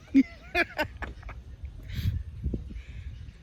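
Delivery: breathless high-pitched giggle; high wheezing laugh. Real, not acted. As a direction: laugh helplessly at the joke. Laughing at own story.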